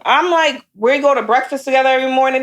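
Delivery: ordinary talk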